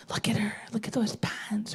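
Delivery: whispered, hushed gossip. Retold or imitated. Sisters whispering about the niece, hushed. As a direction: whisper it behind her back.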